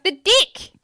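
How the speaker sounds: high, loud New Zealand vowel in "dick"; New Zealand accent. Retold or imitated. Friend insisting to baffled listener, New Zealand accent. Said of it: "she means the deck".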